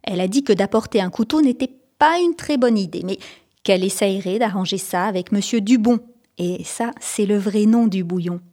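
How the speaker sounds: ordinary delivery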